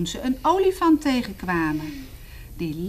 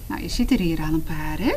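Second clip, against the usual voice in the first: ordinary delivery, nothing marked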